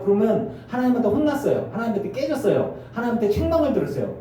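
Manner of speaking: normal talking voice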